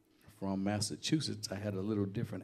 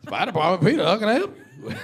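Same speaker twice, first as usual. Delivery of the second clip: louder, higher New England greeting through laughter; repeated New England accent; 11 semitones higher, 11 dB louder than usual. Retold or imitated. Firefighter repeats the New England phone greeting, laughing. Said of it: "What'd you say?".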